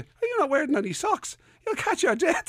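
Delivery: high fussing scold, mock-worried; lightly voiced nagging other half; fussing relative. Other half fussing about bare ankles, high scold. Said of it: "somebody turns around and goes".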